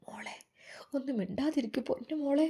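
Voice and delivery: soft, begging whisper; pleading whisper. Acted. Mother begs her rude daughter in a whisper. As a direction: whisper a plea to keep quiet.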